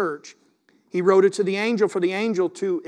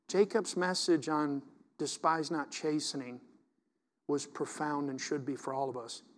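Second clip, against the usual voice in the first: ordinary talk, nothing marked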